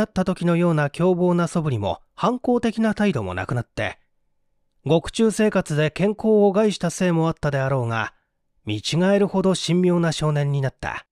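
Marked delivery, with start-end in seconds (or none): none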